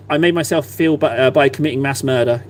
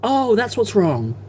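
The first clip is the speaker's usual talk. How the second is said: realising; 5 semitones higher, slower than usual. Retold or imitated. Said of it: "went from confused to like".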